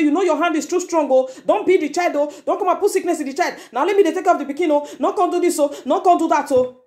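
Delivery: high-pitched mock pleading, insistent; imitating a protesting mother. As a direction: protest like a mother shielding the child. Mimics mother protesting spanking.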